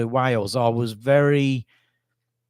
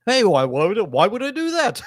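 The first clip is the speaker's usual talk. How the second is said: jokey, higher and louder refusal; amusingly; 9 semitones higher, 3 dB louder than usual. Retold or imitated. Boy jokingly refusing to date Rachel, playful and high. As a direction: laugh it off as the class clown. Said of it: "I said it, like, amusingly".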